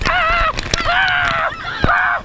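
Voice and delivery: very high shrieks; high scream. Real, not acted. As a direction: shriek as the water hits. Hit by the spray.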